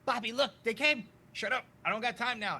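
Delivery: put-on high, loud child voice; excited kid then gruff dad. Kid calls his dad, father brushes him off loudly.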